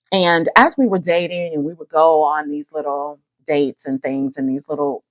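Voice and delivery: ordinary talk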